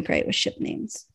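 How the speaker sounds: playful whispered praise; hushed mock encouragement. Real, not acted. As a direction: whisper it back teasingly. Whispering back to cohost.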